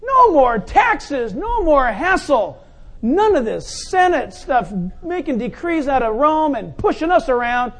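loud, high excited shouting; crowd shouting. Acted. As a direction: cheer like a liberated crowd. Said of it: "People are shouting".

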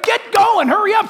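high, loud hurry-up shout; urgent God. Pretend. God hurries Moses along, a loud high shout. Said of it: "Isn't that great?".

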